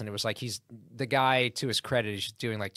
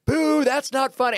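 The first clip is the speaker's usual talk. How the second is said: high, loud offended heckler booing; mock outraged audience member, loud and high; hammed-up offended boo from the crowd; 9 dB louder than usual. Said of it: "And you hear this".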